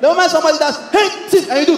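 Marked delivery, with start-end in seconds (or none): 0.9-1.5 high loud sneeze sound, laughing; mock sneeze. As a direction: fake a big sneeze. Sneeze imitated again.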